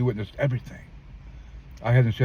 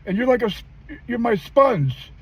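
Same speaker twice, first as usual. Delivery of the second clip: tearful mother; 13 semitones higher than usual. Retold or imitated. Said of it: "She started crying".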